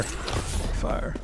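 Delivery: breathy whisper. Real, not acted. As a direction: whisper the word. Echoing fire, whispered.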